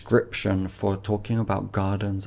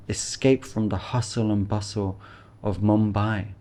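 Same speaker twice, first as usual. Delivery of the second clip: ordinary talk, nothing marked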